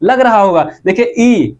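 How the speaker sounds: usual speaking voice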